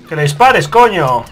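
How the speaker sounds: loud angry shout; exasperated yell. Real, not acted. Player ordering his partner to shoot, loud exasperated yell. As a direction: bark the order in frustration.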